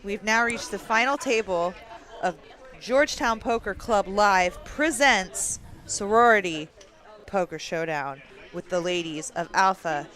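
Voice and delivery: host the final table like live TV; mock live poker commentary to viewers; announcer voice. Mock tournament broadcast.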